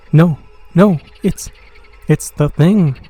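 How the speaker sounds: horrified cry. A narrator's reading. Men see the monster at the end.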